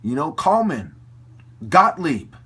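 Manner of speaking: loud, slow, spitting out the names; furious yell. Real, not acted. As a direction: shout them down with disgust. Ranting, naming the collaborators.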